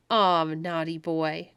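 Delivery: arch teasing. A narrator's reading. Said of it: "in an arch and laughing tone".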